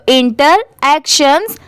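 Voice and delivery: "Interactions" split slowly as inter, then actions; slow, in parts. Shown as a demonstration. Teacher putting both parts together for learners, "interactions" slowly split. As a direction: say "interactions" in two separate, careful parts. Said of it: "अब दोनों साथ में मिला देंगे".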